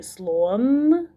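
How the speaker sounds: "слон" with a long humming final "н"; rising, lingering "н" on "слон"; stretched last sound. Shown as a demonstration. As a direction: hold the "н" ending "слон". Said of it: "Останній звук у слові".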